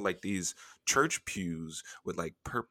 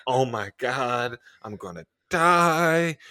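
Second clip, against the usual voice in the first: slow, higher, louder mock terror; mock panic; 5 semitones higher, 5 dB louder, slower than usual. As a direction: play up the fear of dying. Retold panic in church.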